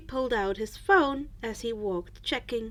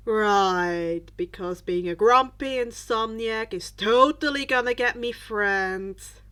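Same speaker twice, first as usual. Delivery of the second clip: sarcastic; 3 semitones lower, 6 dB louder than usual. Acted. Sarcastic reply.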